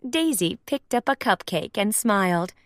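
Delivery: ordinary delivery, nothing marked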